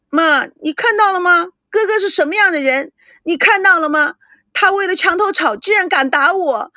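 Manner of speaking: wailing complaint. A narrator's reading. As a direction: call to mother, outraged and hurt. Slapped sister appeals to mother.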